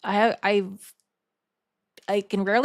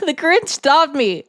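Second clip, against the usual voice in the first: laughing; 6 semitones higher, 6 dB louder than usual. Real, not acted. Laughing over the cringe.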